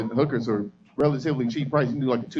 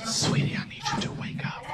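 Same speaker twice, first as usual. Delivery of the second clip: soft, breathy whisper to wake her; gentle wake-up whisper; 8 dB quieter than usual. Retold or imitated. Waking the girl to tell her, soft whisper. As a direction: coax her awake in a hushed voice.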